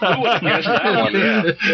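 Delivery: laughing. Real, not acted. Joking about alcohol curing depression.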